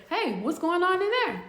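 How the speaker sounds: high-pitched questioning call; officer calling in. Retold or imitated. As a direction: imitate a female officer checking on a cell. Officer calls into the cell.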